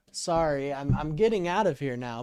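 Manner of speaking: ordinary talk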